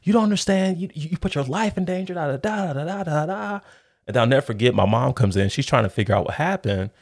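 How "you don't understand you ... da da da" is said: higher-pitched scolding, surprisingly soft; older sister lecturing her brother after the stop, voiced higher and softer; sister unleashing. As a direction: scold him, lightly mimicking the sister. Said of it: "she unleashes on me".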